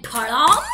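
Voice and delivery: loud thud as he falls; crash sound. Acted. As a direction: bang it out. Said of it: "he was like".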